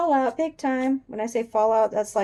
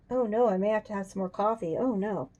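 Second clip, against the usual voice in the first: ordinary delivery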